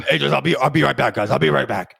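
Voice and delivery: loud hurried call to a group. Retold or imitated. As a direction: imitate a boss shouting on the way out. Boss calling out to waiting trainees.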